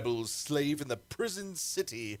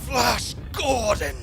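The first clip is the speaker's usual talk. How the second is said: loud, slow, higher cry of the name; shocked shout; 10 semitones higher, 7 dB louder, slower than usual. Acted. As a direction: call out the name in alarm. Shocked cry at hero's arrival.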